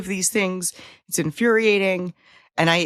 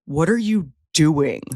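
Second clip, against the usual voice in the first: slow, deliberate "what are you doing", falling at the end; interrogating tone; 3 semitones lower, slower than usual. Shown as a demonstration. Cohost showing how the question could be said, slow interrogation. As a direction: spell it out like an interrogation. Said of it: "if you say it like".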